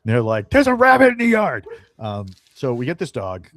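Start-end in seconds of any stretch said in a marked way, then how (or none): 0.5-1.7 high, loud frantic shout; comic high-pitched holler; excited dog yelling. Acted. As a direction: yell it like an overexcited dog raising the alarm. Voicing dogs interrupting with urgent news.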